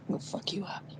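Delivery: soft, menacing threat; menacing whisper. Retold or imitated. Threatening the spider with the warhammer in a whisper. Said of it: "and I said".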